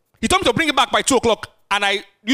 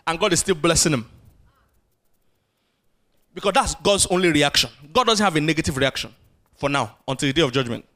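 ordinary talk, nothing marked

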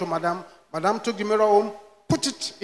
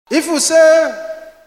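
preaching emphasis; 8 semitones higher, 7 dB louder, much slower than usual. Acted.

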